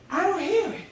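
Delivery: high-pitched, lifted discovery; dawning realisation. Acted. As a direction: say it as a sudden realisation. Realising the voice is gone.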